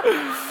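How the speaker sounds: high, drawn-out victory yell; hammed-up savage cheer; triumphant war cry. Imitating the group after the spider is squished, triumphant yell. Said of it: "And we're like".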